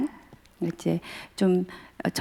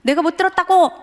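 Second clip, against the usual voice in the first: higher, louder angry shout; father shouting; 10 semitones higher, 5 dB louder than usual. Retold or imitated. Father yelling at his son in the car, angry shout. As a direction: bellow it at the kid in the back seat. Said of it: "점점 서로 격해".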